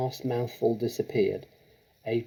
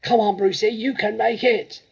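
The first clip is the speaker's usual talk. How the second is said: shout; 11 semitones higher, 8 dB louder than usual. Acted. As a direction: cheer a friend on from the crowd. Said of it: "someone shouted".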